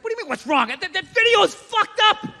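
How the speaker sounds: indignant. Retold or imitated. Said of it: "I'm just like".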